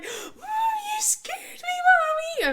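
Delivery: put-on high, slow, tearful little boy; crying child. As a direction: whimper it like a scared kid. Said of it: "he's all like".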